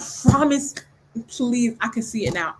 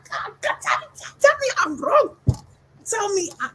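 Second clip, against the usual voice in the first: pleading; 11 semitones higher than usual. Real, not acted. Begging the author in disbelief.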